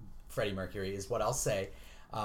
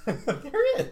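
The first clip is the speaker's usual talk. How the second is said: laughing; 7 dB louder than usual. Real, not acted. Joking reply to wife.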